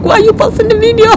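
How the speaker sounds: high, loud, fast irritated demand; annoyed outburst. Retold or imitated. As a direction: snap it out in annoyance. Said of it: "I get so angry".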